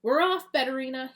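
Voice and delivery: loud, high cheer; cheerful cry. Acted. Eagle Boy carrying the chicken away, loud cheer. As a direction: whoop as you lift off. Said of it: "he cried".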